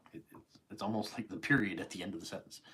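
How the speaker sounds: normal talking voice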